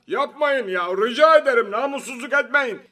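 high-pitched, strained pleading outburst; exasperated anger. A narrator's reading. Prime minister protests against the applause, exasperated. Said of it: "bu alkışlara kızan".

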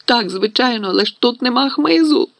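high, distressed cry; rising, panicky lament; tearful wail. A narrator's reading. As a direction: wail it in despair. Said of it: "заплакала Герміона".

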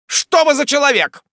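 loud, high, impudent demand; insolent shout. A narrator's reading. Jingle challenges the lawyer, insolent shout. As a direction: fire it out brazenly. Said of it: "закричал мистер Джингль таким дерзким и наглым тоном".

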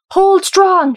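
high, very loud command; roar. A narrator's reading. Dees pushes through to the crew, roaring. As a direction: roar it over the crowd. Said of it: "she roared as she pushed through".

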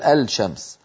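الشمس as "al-shams", L sounded before sh; faulty clear lam. Shown as a demonstration. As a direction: say الشمس without merging the L, "al-shams". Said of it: "ما أحد يقول".

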